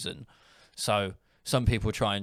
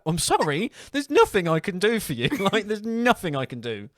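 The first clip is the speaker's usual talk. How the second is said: high, loud retold giggly refusal; laughing apology; 8 semitones higher, 5 dB louder than usual. Clerk laughing down the phone at customer, high amused apology. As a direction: say it through stifled laughter. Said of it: "I start laughing down the phone".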